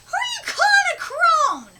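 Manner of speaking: high, loud indignant shriek with a laugh; the old witch bristling at an insult; offended crone. Acted. Old witch shrieking at the pupil who called her a crone.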